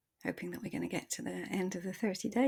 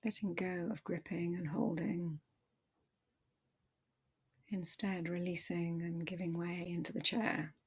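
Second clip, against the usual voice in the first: calm body-scan voice, softer than the intro; guided meditation; 4 dB quieter than usual. Acted. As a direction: ease the listener into their body, gently.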